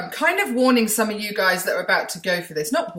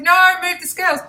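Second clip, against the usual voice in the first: insistent husband; 11 semitones higher, 6 dB louder than usual. Retold or imitated. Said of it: "And he said".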